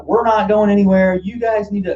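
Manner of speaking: high, loud mock yell at the police; imitating a yelling protester. Protester yelling down at the police, high mock yell. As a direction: shout it up like a protester on the church steps. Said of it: "he's yelling and he's just like".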